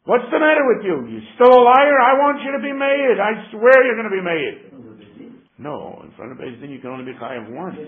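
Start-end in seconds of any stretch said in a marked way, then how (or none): 0.1-4.7 loud, higher-pitched yelling at a liar; retold yelling. Plaintiff yelling at the denier in court, loud and angry. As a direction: bark it at him like an angry judge. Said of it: "he yells at him".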